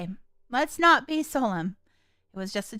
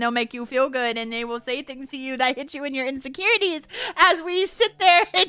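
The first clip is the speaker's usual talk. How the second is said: high, loud, mock-outraged rant; loud laughing rant; 9 semitones higher, 7 dB louder than usual. Real, not acted. Player ranting about the game company, high and loud. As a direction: rail against the game with comic outrage.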